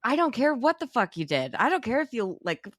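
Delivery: high, heated mock rant; mock Adam rant. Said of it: "Adam's like".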